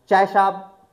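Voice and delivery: loud short chaiwala call; tea vendor call. Shown as a demonstration. Teacher imitates vendor offering tea loudly. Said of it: "मैंने बताया ना अभी".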